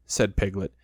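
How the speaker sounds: ordinary delivery, nothing marked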